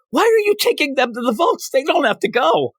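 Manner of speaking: very high-pitched mock outrage; exasperated objection. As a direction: squawk in disbelief as the scolding partner. Doc Ock objects to Peter's order.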